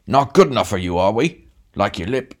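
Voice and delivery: big man picking a fight; bullying snarl. A narrator's reading. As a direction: square up to the little man. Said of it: "rounded suddenly on the short man".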